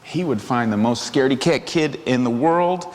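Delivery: plain reading voice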